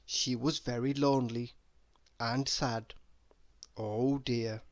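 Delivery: usual speaking voice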